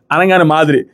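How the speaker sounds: ordinary talk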